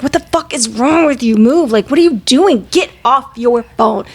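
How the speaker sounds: mild mock fury at another driver; acted road-rage anger. Mom replaying her rants at drivers, moderately angry. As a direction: reenact an exasperated rant behind the wheel. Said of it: "Like, just very angry".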